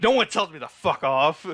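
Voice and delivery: hammed-up furious retort, imitating the toad; angry Garaga quote. Garaga snapping at Boruto for telling him off, mock angry. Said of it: "and he's like".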